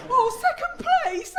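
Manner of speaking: high, slow, laughing sneer at second place; mock scornful cry. Comic mocking a runner-up, high laughing sneer.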